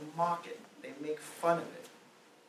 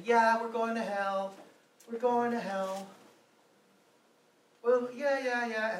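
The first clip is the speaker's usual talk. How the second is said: higher, taunting mock of the marchers; 7 semitones higher than usual. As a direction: toss it off like a jeering crowd. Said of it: "openly mock and just say".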